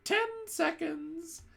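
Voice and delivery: very high, drawn-out witch countdown; witch crying out. A narrator's reading. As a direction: stretch out the seconds gleefully. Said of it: "cried the Grand High Witch".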